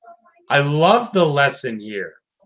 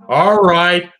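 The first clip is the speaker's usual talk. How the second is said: ordinary talk, nothing marked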